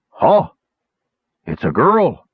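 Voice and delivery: acted grunt of discovery; grunted surprise. A narrator's reading. Herder unmasking the captured raider, grunted surprise. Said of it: "he grunted".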